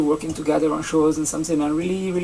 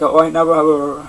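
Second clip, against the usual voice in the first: "never ever" with English R's, louder; English accent; 8 dB louder than usual. Retold or imitated. Playing the drinking game Never Ever, in a mock English accent. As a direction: imitate an English girl saying "never ever". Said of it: "hear the R's pronounced in English".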